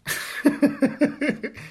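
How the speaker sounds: breathless high giggle; airy high laugh. Real, not acted. Speaker laughing at their own paradox, airy high laugh.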